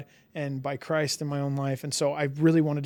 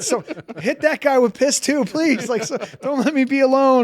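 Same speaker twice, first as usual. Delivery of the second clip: higher, louder joking plea; mock plea; 9 semitones higher, 7 dB louder than usual. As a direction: beg the heavens in jest. Jokingly begs other band hit.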